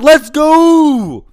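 Let's go screamed at the screen; screaming. Real, not acted. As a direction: yell it out in real delight. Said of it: "sorry if I hurt your ears".

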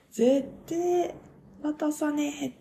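high, half-acted vow never to hand it over; defiant character line. Fan acting out the ketchup scene to listeners, mock defiant. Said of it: "ドーンみたいな".

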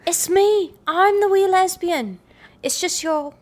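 put-on high-pitched Northern Irish accent; Derry Girls Irish accent. Quoting Derry Girls to co-host, high Irish accent. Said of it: "go into it with Derry Girls".

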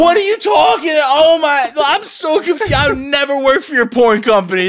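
loud, high disbelieving yell at his friend; outraged yelling. Real, not acted. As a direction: shout it like you can't believe your ears. Outraged at partner's claim.

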